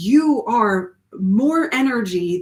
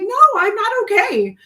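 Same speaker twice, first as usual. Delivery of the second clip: raised, higher insistent no; retold tearful reply; 11 semitones higher, 7 dB louder than usual. Telling the concerned strangers she is not okay, raised and high. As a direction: answer the strangers loud and high. Her reply while sobbing.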